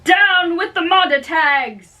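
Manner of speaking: very high, shouted rallying cry; battle cry. Acted. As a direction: whip up the street against the other house. Crowd battle cry in brawl.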